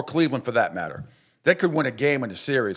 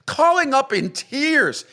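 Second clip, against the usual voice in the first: much higher, slow sneering repeat; mocking disbelief; 10 semitones higher, 3 dB louder, slower than usual. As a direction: drag it out in ridicule. Mocking callers in tears.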